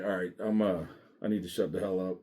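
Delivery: low, subdued backing down; chastened retreat. Retold or imitated. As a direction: drop the volume and retreat, chastened. Backing down after being yelled at.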